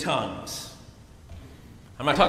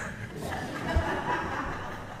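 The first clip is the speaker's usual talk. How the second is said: airy laugh; 12 semitones higher, 9 dB quieter than usual. Real, not acted.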